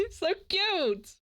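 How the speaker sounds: high delighted laugh; laughing squeal. Real, not acted. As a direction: giggle out cute.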